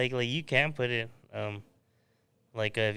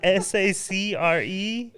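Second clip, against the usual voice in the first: slow spelling; 8 semitones higher, 6 dB louder, much slower than usual. Shown as a demonstration. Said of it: "spelling slowly".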